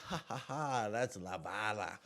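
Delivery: mock "ha ha ha" laugh sliding into slow words; put-on laugh, drawn out; imitated laughing. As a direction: impersonate a friend cracking up. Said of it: "He starts laughing again".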